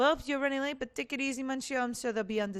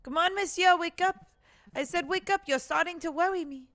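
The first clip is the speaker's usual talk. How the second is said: high, louder pleading to wake up; urgent calling; 6 semitones higher, 4 dB louder than usual. Acted. Fisherman trying to wake the lawyer, urgent calling. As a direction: rouse someone who won't stir.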